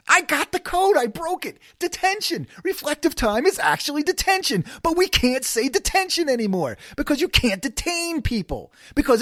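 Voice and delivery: high-pitched manic realization; high excited rant. Retold or imitated. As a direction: crack the code in a high squeal. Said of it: "And I said".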